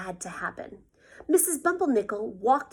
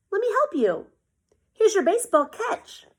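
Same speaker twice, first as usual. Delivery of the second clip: cheerful helper; 10 semitones higher, 6 dB louder than usual. Acted. Felix helping a classmate.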